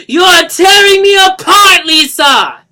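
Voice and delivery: huge high anguished shout, slow; shouting with all his might. Acted. Johnny pulling at the air with his fist, huge anguished shout. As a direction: scream it with everything. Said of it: "exclaiming with all his considerable might".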